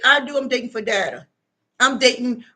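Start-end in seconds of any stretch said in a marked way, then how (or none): none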